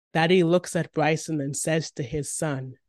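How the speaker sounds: usual speaking voice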